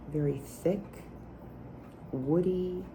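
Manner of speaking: normal talking voice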